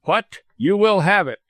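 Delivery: high, indignant outburst; angry. A narrator's reading. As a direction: cry it out angrily. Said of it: "cried Ellis angrily".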